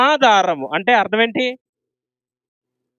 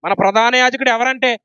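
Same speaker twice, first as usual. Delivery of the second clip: ordinary talk, nothing marked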